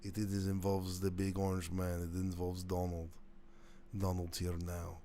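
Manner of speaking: lightly put-on Russian accent. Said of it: "That's when I poached the accent".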